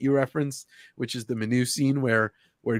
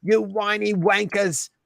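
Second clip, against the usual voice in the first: hammed-up Cockney accent, higher and drawn out; over-the-top British accent with stretched vowels; 5 semitones higher, slower than usual. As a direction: greet the crowd in a mock-Brit swagger. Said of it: "That was the best British accent".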